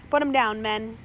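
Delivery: loud order to the crew; shouted. A narrator's reading. First mate telling his crew to disarm, shouting. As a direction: call it out to the men. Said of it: "Slank shouted to his crew".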